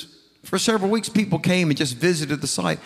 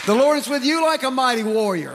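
climactic proclamation; 7 semitones higher than usual. Acted.

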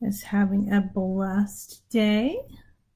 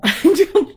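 laugh; 9 semitones higher, 9 dB louder than usual. Real, not acted. Laughs at her own yawning.

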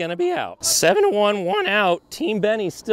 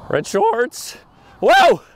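normal talking voice

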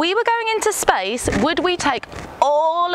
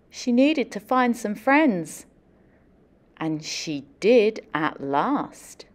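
picture book story narration; storybook reading; 6 semitones lower than usual. Acted. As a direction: tell it gently to a class of kids. Reads picture book aloud.

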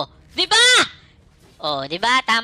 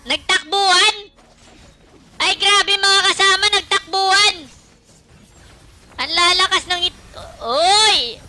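loud, high hyped yelling at the fight; excited shouting; 12 semitones higher, 8 dB louder than usual. Real, not acted. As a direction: yell it out, thrilled by the teamfight. Hyped shouting during game fight. (sigaw na sabik na sabik)

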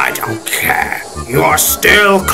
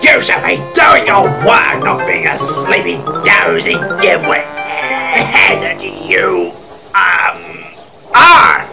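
rough, irate telling-off; scolding engine; 3 semitones higher, 4 dB louder than usual. Acted. Engine scolding another for dozing, irate. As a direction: berate him with mounting force.

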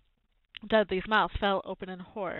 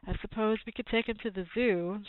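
ordinary delivery

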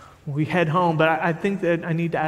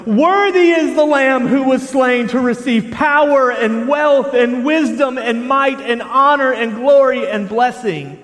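high, slow, full-voiced cry of praise; booming scripture shout, each word drawn out; loud proclamation; 10 semitones higher, 10 dB louder, slower than usual. A narrator's reading. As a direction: shout it out like a vast choir of angels. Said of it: "saying with a loud voice".

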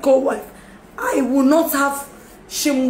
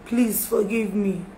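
ordinary talk

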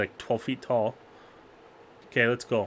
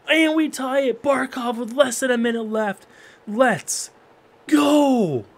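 loud, high cheer as the game is tied; excited shout; 11 semitones higher, 5 dB louder, slower than usual. Real, not acted. Gamer cheering the late tying goal, loud excited shout.

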